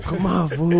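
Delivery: high, loud hyped-up challenge; mock taunt. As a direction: bounce it like a kid itching to fight. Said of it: "jumping up and down, going".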